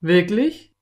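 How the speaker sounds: loud question swooping upward; cowboy taken aback, voice rising sharply; surprised. A narrator's reading. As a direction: ask in open surprise. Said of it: "fragte Slim überrascht".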